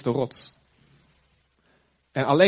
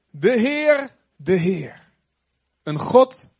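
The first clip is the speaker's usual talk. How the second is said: proclamation; 5 semitones higher, 5 dB louder, much slower than usual. Acted. Proclaiming God's name.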